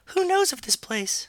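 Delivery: very high put-on girl's voice; Ellie's falsetto. Younger sister asking her boyfriend, in high falsetto. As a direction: squeak it in falsetto.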